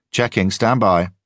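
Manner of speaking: tightening, tense radio voice. A narrator's reading. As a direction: control rising tension. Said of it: "His voice was winding tighter now".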